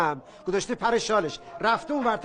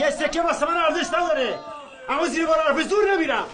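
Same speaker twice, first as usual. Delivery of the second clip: angry shout; 7 semitones higher than usual. Acted. Seller yells at the customer over one coin, angry shout. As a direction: shout him down, you won't be bullied.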